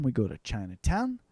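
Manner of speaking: ordinary talk, nothing marked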